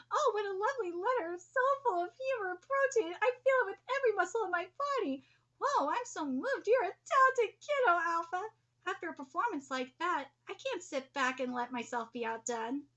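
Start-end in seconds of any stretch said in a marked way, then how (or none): 0.1-8.0 higher, louder hammed-up praise; gushing. Ryu gushing over the muscle letter, hammed-up delight. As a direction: overdo the delight.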